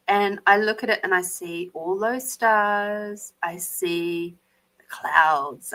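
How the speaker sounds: usual speaking voice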